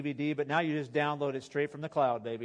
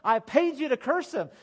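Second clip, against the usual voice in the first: much higher, loud indignant outburst; angry king; 11 semitones higher, 9 dB louder than usual. Retold or imitated. The king yelling at Balaam for blessing instead of cursing. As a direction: demand it like a cheated king. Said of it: "And the king's like".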